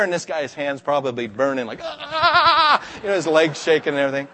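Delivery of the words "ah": high pained yelp; mock yelp of pain. Teen with burning pocket yelping in pain. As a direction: yelp as the pipe burns.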